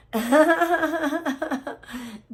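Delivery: long, high burst of laughter; gleeful drawn-out laugh; real cackle. Real, not acted. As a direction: cackle in a rapid string. Laughs before mocking Beta.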